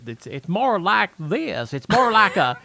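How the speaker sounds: slow Dayton accent, almost Southern; middle Ohio accent. Shown as a demonstration. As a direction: demonstrate a drawn-out, near-Southern Ohio accent. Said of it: "middle Ohio Dayton, uh, accent".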